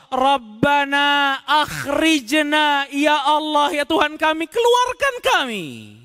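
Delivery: high crying shout, slow and drawn out; screaming to God. Acted. The damned crying to God from hell, anguished shout. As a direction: howl from the fire to be let out. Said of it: "Mereka teriak kepada Allah".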